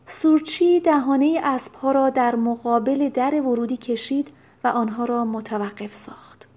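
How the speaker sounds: plain reading voice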